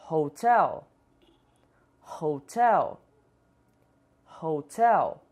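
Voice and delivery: Hotel with a breathy English h, stress on tel. Shown as a demonstration. Teacher models Hotel for Brazilian learners, breathy h. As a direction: say Hotel with an airy h, not a Portuguese R. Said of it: "Vamos tentar repetir cada palavra".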